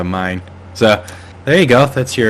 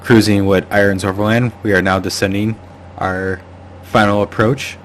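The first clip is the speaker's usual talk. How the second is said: ordinary delivery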